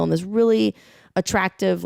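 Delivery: ordinary talk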